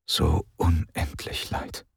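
slow, soft whispered regret; slow quiet lament; hushed inner thought. A narrator's reading. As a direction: let the sorrow hang, slowly and softly. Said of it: "dachte Dilith".

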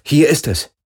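high, loud exclamation; excited discovery. A narrator's reading. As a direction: call out that you found it. Excited arrival at the goal. (aufgeregter, lauter Ausruf)

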